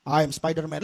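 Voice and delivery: deadpan heroic reply; low deadpan voice. Acted. Resident answers postman.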